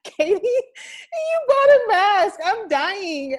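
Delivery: high, slow laughing voice. Real, not acted. Laughing at the mask.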